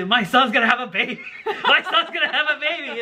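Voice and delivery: high, laughing mock mom voice; laughing joy. Son imitating his mother's overjoyed laughing cry. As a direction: giggle it through happy tears. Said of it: "she's so excited".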